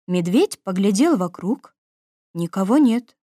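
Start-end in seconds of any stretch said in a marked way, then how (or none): none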